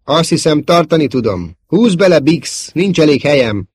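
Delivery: loud, high-pitched battle talk; strained urgency. Acted. Damaged pilot insisting he can hold it, urgent. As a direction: call out while fighting the controls.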